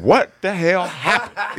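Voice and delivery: high, slow, laughing question; laughing incredulous ask. Retold or imitated. Comic asks the silent barbershop, laughing. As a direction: blurt it out through a laugh.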